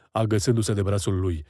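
ordinary delivery, nothing marked

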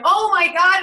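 a sudden high, loud cry of emotion; overwhelmed exclamation. Retold or imitated. Recalling breaking down in front of strangers, overwhelmed high cry. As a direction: let the feeling burst out. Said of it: "I couldn't stop it".

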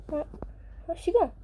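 ordinary talk, nothing marked

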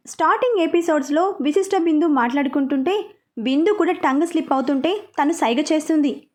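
usual speaking voice

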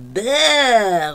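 loud, drawn-out yuck sound; disgusted bleh. A narrator's reading. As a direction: cry out in disgust at the dirty shoe. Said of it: "roept opa". (luid vol walging)

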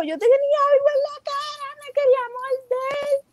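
high, frightened imitation of herself; telling dad something tried to bite her, in a high panicked voice; retold panic. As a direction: replay the scare in a high frantic voice. Panicked answer to her father.

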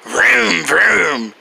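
low dropping engine rumble; car engine vroom. Pretend. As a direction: let the engine roar down. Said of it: "Right, I'm driving a car".

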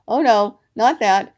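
loud, dismayed outburst; gasped alarm. A narrator's reading. Rabbit dreads Pooh getting honey, loudly alarmed. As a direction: exclaim in sudden dread. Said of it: "gasped Rabbit inside his hole".

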